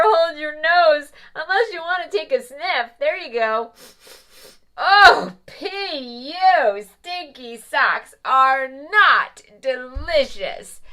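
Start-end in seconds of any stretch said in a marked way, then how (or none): 4.8-10.8 loud, slow yuck; disgusted. Acted. Mother Goose tells children stinky socks are not delicious, disgusted. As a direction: groan at the smell. Reacting to the smell.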